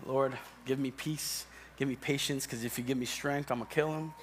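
low, soft plea to the Lord; quiet, measured comic prayer; restrained prayer for patience. Acted. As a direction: pray it under your breath, holding back. Muttered prayer for patience at work.

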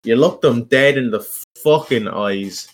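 usual speaking voice

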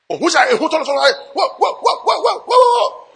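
hammed-up terror rising to a squeal; mock terrified wailing.

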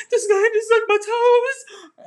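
high, loud, slow hammed-up wail; crying to the roommate about the toe, hammed-up wail; mock wailing. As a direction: wail it like a half-fake sob story. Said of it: "I'm, like, crying".

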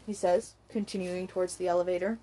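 usual speaking voice

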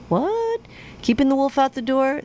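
high, baffled question; puzzled surprise. Retold or imitated. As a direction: play it confused and incredulous. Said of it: "people kinda look at me like".

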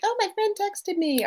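high-pitched happy squeal of an imagined phone user; mock delight. Therapist imitating someone pleased by a phone message, mock delight. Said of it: "a little bit of happy hormone".

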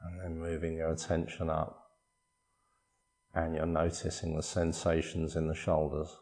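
soft, unhurried body-scan voice with long pauses; guided meditation. Acted. Meditation teacher guiding listeners through a body scan, calm guided meditation. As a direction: calmly guide them through relaxing, phrase by phrase.

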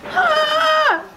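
high mock shriek; retold scream. Classmates reacting to pale Matt, mock shriek. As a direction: yelp in playful fright.

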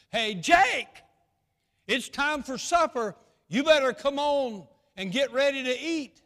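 high, loud call from the back door; hollering sister. Retold or imitated. Older sister calls Jake to supper, loud high holler. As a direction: shout it across the yard. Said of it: "she stepped to the back door and she said".

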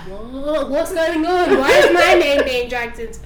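high, loud laughing bewilderment; mock bewildered. Ellie baffled at her name, high laughing. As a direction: play her confused and laughing. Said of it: "Ellie was just like".

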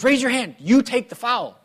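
high, loud insistent command; loud retold instruction from the sideline; dad's repeated order. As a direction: press the kid firmly, dad as coach. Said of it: "And he goes".

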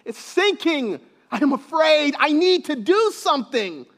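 high, urgent cry of alarm; stretched-out panic, easing slightly after the first cry; panicked. Acted. Preacher voicing the disciples in the sinking boat, panicked. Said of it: "may be feeling that panic".

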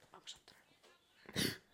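slow conspiratorial whisper. Retold or imitated. Deciding to sneak into the message, slow whisper. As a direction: breathe it like a secret plan. Retelling her secret decision.

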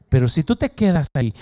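ordinary talk

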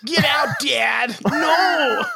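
put-on high-pitched bratty kid whine, stretched out; whiny child voice. Kid yelling at Dad to get out, whiny child voice. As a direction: wail it like a child refusing a parent. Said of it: "whiny child voice".

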